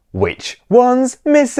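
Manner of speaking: game host announcing title; game show title. Shown as a demonstration.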